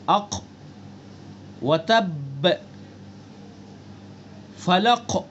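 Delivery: final qaf and ba released with an echo; qalqalah bounce on final letters. Shown as a demonstration. Teacher showing students the returning sound, echoed final qaf and ba. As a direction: bounce the last consonant of each word. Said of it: "ایک لوٹتی ہوئی آواز نکالنا".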